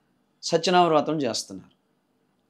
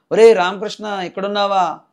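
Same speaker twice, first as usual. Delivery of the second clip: calling out; 8 semitones higher, 8 dB louder than usual. Retold or imitated. Friend calling out at bus stand.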